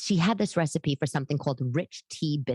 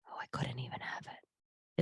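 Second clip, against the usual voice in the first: whispered, much softer, slow; disgusted whisper; 4 semitones lower, 11 dB quieter than usual. Real, not acted. Whispering disgust about the stale tea cakes. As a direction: breathe it out in disgust.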